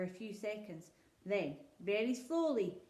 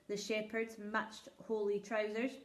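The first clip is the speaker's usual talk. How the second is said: ordinary delivery, nothing marked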